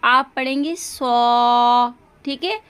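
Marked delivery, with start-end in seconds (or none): 0.8-1.9 सो louder, slow, rounded o; so, not sa. Shown as a demonstration. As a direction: say सो with a clear o, not सा. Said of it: "तो सा जो है वो गलत है". (सा नहीं, सो)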